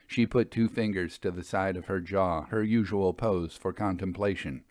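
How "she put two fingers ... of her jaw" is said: usual speaking voice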